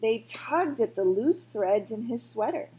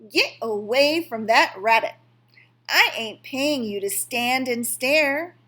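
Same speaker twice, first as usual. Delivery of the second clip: loud, sharp scold; 3 semitones higher, 9 dB louder than usual. A narrator's reading. Old woman scolds the boy for staring, loud. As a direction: tell the boy off.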